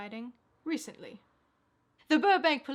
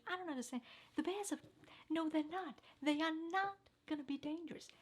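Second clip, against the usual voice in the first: quiet protest; 4 semitones higher, 9 dB quieter than usual. Acted. Quarrel with offscreen Bob.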